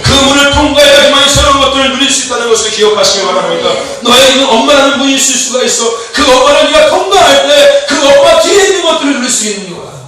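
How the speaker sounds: loud, driving sermon delivery; full-force preacher calling out; forceful preaching. Acted. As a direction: preach it out to the whole room. Preaching to the congregation.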